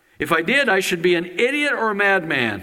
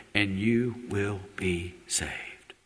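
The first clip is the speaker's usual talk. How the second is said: much softer, slow and low; soft promise; 8 semitones lower, 8 dB quieter, much slower than usual. Real, not acted. Preacher assuring the listeners of salvation, soft slow promise. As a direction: lay the promise down gently, slowly.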